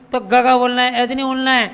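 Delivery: usual speaking voice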